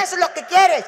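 angry threat. Retold or imitated. Mother menaces her daughter about the owl. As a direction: demand it like a furious mother.

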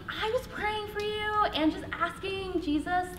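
high, slow, stilted nervous delivery. Acted. Explaining her prayer to a near stranger, nervously. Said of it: "nervous, talking like this".

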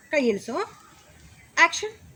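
usual speaking voice

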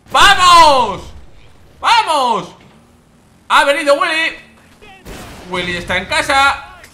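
very high, loud celebration; full-voice, high-pitched cheering; triumphant shout. Real, not acted. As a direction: yell it out like a crowing winner. Celebrating during the game.

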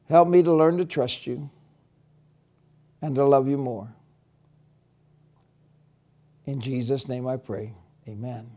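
gentle, unhurried prayer voice; closing prayer. Acted. Teacher leads the children in a closing prayer, gentle and unhurried.

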